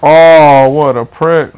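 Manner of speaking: a sudden loud yell on the oh, then muttered; explode, then spit the insult; angry yell. Real, not acted. Player yelling at an annoying opponent, sudden loud angry yell. Gamer raging at enemy.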